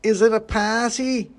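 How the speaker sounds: high, loud, slow tipsy voice; excited boozy Christmas pudding; drunken pudding. A narrator's reading. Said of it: "has definitely been drinking".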